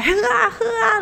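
high laughing cheer; mock toast-pushing; imitating a rowdy relative urging drinks; cheering drinker. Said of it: "自己在那边很嗨".

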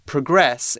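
"progress" stressed on the second half, pro-GRESS; verb stress, second syllable. Shown as a demonstration. Teacher demonstrates the verb form, stress on second syllable. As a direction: hit the second syllable of "progress", weak first vowel. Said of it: "emphasis on the second half of the word".

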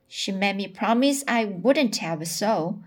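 plain reading voice

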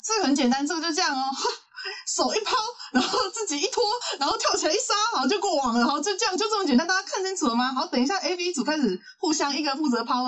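high-pitched breezy demonstration, mocking a teacher; imitated PE teacher. Teacher demonstrates toss and spike to beginners, mock breezy instruction. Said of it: "他就示范一次".

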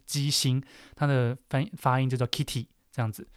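ordinary delivery

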